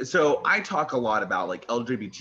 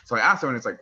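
ordinary talk

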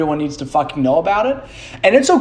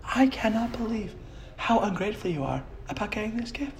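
mock scandalized whisper; 7 semitones higher, 11 dB quieter than usual. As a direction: whisper the scolding like an appalled stepmom. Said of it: "she's like".

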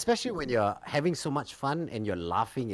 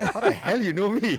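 surprised, laughing; 10 semitones higher, 5 dB louder than usual. Retold or imitated. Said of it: "I'm like".

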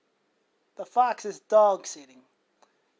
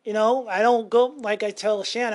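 ordinary talk

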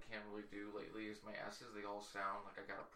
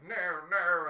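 protesting no; 8 semitones higher, 14 dB louder than usual. Retold or imitated. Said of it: "he was like".

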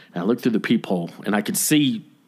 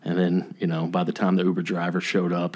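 ordinary delivery

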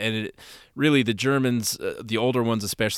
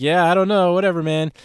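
dismissive; 7 semitones higher, 5 dB louder than usual. Retold or imitated. Brushing off a drunk man's claim.

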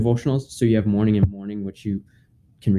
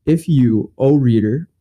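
raised, formal devotional reading; old english; 4 semitones higher, 4 dB louder, slower than usual. Acted. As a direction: read the old text aloud with gravity. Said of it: "I will read in Old English".

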